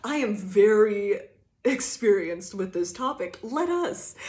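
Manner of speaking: bright teasing expert voice; mock sweet confidence. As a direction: sweetly set up the takedown. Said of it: "you're like".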